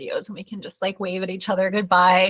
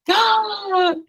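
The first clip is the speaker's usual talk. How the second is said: sharp high gasp of joy; thrilled little gasp; delighted gasp; 11 semitones higher, 5 dB louder than usual. Real, not acted. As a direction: gasp like you just read great news. Reacting to an excited comment.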